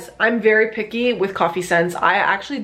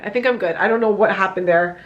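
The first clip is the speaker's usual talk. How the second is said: ordinary talk, nothing marked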